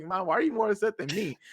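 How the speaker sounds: retold protesting son. Son to upset mom after losing, protesting. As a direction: imitate a baffled son.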